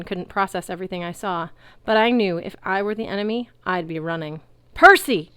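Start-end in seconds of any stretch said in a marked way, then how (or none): 4.7-5.3 loud high call across a crowd; big carrying shout. A narrator's reading. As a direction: call over a sea of centaurs. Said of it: "Chiron shouted across the sea".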